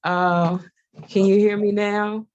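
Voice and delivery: normal talking voice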